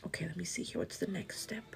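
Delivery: whispered, barely voiced self-talk; muttering to herself. Real, not acted. Vlogger checking what comes next, muttering to herself. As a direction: say it under your breath, very softly.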